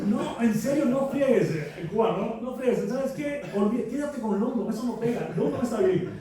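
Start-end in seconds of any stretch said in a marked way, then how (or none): 0.1-1.5 higher, mock-incredulous scoff; retelling a fellow writer scoffing at his real surnames; scornful disbelief. 2.2-6.1 higher, mock-incredulous scoff; retelling a fellow writer scoffing at his real surnames; scornful disbelief.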